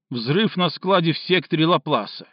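ordinary delivery, nothing marked

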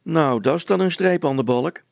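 high, loud scornful jibe; sneering. A narrator's reading. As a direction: scoff with exaggerated amazement. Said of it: "smaalde de veldwachter".

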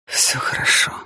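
утешающий шёпот; успокой плачущую жену полушёпотом; успокаивающе. A narrator's reading. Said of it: "успокоил её Дэн". (ласково успокаивая, шёпотом)

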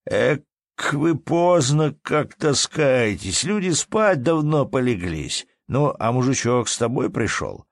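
high-pitched, drawn-out sleepy grumble; lightly voiced drowsy innkeeper; lazy drawl. A narrator's reading. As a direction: stretch the words lazily after a yawn. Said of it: "зевнул и продолжал лениво".

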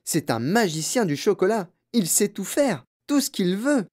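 high-pitched fervent admiration; exclaiming old man. A narrator's reading. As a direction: enthusiastic grandfather's praise. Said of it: "s'exclama le vieil homme".

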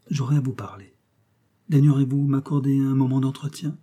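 ordinary delivery

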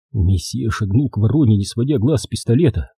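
ordinary delivery

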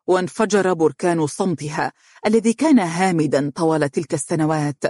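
usual speaking voice

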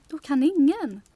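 loud, high payoff line; triumphant catchphrase. Acted. Partner delivers the catchphrase to Poppe, loud and triumphant. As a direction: land it like a proud slogan.

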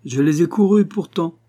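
proud swaggering boast at the dinner table; cocky boast. A narrator's reading. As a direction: crow it like a young rooster. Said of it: "en me redressant comme un jeune coq".

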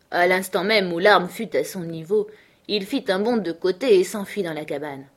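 plain reading voice

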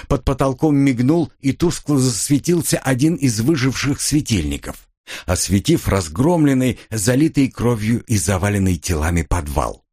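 usual speaking voice

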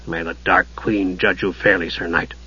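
low, soft gruff jailer; gruff mutter. A narrator's reading. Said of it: "muttered in a gruff voice".

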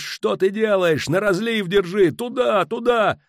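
high, loud frantic directions; desperate shouting, pitched far up; straining yell. A narrator's reading. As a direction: scream urgent steering orders. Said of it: "надрывался Фиш".

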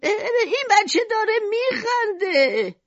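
very high, drawn-out cry of amazement; astonished. Acted. Old customer exclaims over the laughing baby, utterly astonished. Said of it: "با تعجب گفت".

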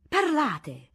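loud high urgent cry; urgent demand. A narrator's reading. Guests pressing Don Giacomo for bad news, loud urgent cry.

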